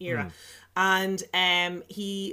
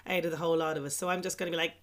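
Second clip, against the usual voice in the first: usual speaking voice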